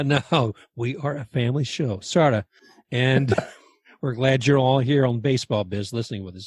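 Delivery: ordinary talk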